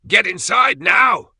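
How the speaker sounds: high, drawn-out full shout; frantic yell. A narrator's reading. Father to his kids at the front door, frantic yell. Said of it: "yelling frantically".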